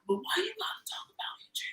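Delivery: tiny high-pitched mock voice; quick, very high, hushed imitation. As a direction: squeak it softly, imitating a man's protest. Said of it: "voice got high as a bitch".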